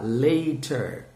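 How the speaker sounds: "later" with a crisp t; British-style t. Shown as a demonstration. Teacher offers students the other way, "later" with a hard t. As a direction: sound the t clearly in "later". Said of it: "o britânico gosta mais de falar".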